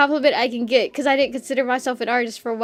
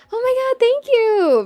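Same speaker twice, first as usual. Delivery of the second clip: mock gushing thanks; 11 semitones higher than usual. Retold reaction to compliment.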